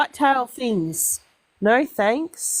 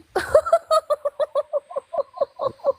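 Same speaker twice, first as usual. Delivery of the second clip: high burst of laughter. Real, not acted. Laughs after mice story.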